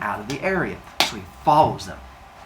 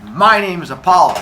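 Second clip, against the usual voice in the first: louder, slightly higher announcement; lightly voiced self-important god; indignant god; 4 semitones higher, 7 dB louder than usual. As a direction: declare it forcefully. Angry god announces himself.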